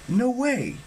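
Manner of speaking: shocked, high-pitched exclamation; surprised outcry. A narrator's reading. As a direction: cry out in sudden disbelief. Percy sees the boat's name.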